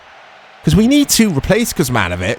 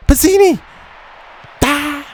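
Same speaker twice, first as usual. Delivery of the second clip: excited shout; 14 semitones higher than usual. Real, not acted. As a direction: yell the name as he shoots. A chance on goal in game.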